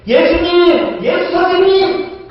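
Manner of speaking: high, loud desperate cry to Jesus. Retold or imitated. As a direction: call out over the crowd, pleading. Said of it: "막 소리 질러요".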